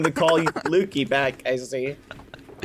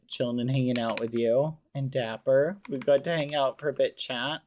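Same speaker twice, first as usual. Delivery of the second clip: usual speaking voice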